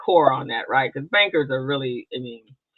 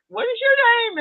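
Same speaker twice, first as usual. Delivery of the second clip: imitated question, leaping very high; mimicked sudden recognition. Attendee approaching her at the break, mimicked surprise. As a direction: mimic someone realizing who this is, pitch shooting up.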